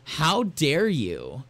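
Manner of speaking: hammed-up scandalised drawn-out protest; wife mock-scolding her husband, drawn-out and huffy; mock outraged wife. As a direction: play the wife's exaggerated indignation, slowly. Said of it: "She's like".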